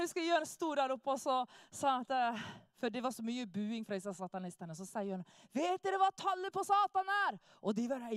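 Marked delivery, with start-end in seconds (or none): 5.5-7.4 higher, louder call to the crowd; preacher challenging satanists from the stage, loud and high; proclaiming call. Retold or imitated. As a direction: throw the question out over a heckling crowd. Jørn calls out to hecklers.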